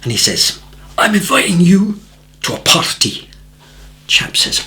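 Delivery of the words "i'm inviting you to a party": burly Scotsman's inviting Scottish brogue; Scottish accent. Retold or imitated. Burly Scotsman at the door invites him, Scottish accent. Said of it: "beautiful Scottish accent".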